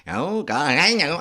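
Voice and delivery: high, over-the-top stage character; hammed-up, high-pitched character voice; big weird character. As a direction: play it far too big. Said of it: "I just do big weird characters".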